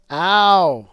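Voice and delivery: sudden loud ow of pain; pained yelp. Real, not acted. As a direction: cry out from the hit. Hit himself in the face.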